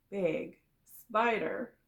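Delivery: plain reading voice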